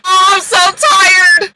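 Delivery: slow, high hammed-up crying; mock weary wail. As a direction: drag it out like a fake sob.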